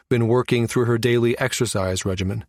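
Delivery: plain reading voice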